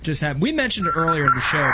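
ordinary talk

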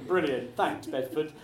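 usual speaking voice

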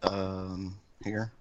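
soft, unexcited reply; unenthusiastic. Real, not acted. Cohost answers how he is after a bad week, unenthusiastic. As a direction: answer the greeting with no enthusiasm. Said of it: "you sound about as excited as Eric".

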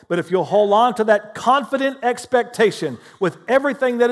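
full-force sermon, loud and driving; preaching. Acted. Pastor preaching to his church with rising fervor. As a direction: preach it hard to the congregation.

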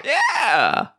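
very high squawky mock cry; drawn-out high creature yeah; high-strung velociraptor yeah. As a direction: screech it like a raptor. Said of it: "sound like a high-strung velociraptor".

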